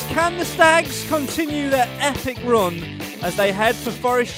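big, high radio sign-off voice; announcer outro. Acted. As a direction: sell the sign-off like a trailer. Show outro over music.

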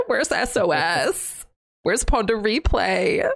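high, slow pleading whine; mock whine. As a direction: stretch it out as a hammed-up plea.

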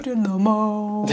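slow, high plummy upper-class voice; plummy posh voice. Pretend. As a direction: say it with a mouthful of plums, slowly and high.

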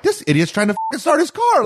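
high, disbelieving exclamation; incredulous. Retold or imitated. Watching the drunk crank his wrecked car, high incredulous squeal. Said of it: "and I'm like".

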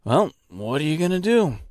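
helpless what-can-you-do tone; verbal shrug. A narrator's reading. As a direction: toss it off as if shrugging. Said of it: "shrugged as if to say".

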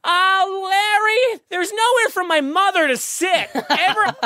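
very high, slow American whine; screechy mock American wife, dragging the words out; American lady. American lady complaining to Larry about seats, shrill mocking falsetto. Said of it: "the lady was like".

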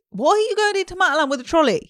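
high, loud mock outrage; mocking shout. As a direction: yell it like a baffled shopper.

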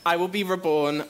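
high voice between tears and laughter; emotional, lifted declaration; tearful but resolute. Real, not acted. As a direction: read the happy ending through real tears. Reading on after breaking down in tears.

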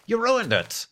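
high-pitched playful outcry; mock accusation. Host to donors who spoiled the total, mock-indignant. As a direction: pretend to blame them, voice jumping up.

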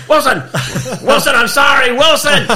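high laughing cry of Wilson; Tom Hanks Castaway impression. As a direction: wail the name through laughter. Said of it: "Tom Hanks castaway impression".

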